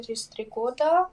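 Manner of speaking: usual speaking voice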